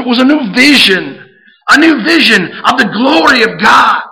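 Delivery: preaching at full force. Acted. Pastor exhorting the church to pray boldly, full force. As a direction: plead it like Moses, then exhort.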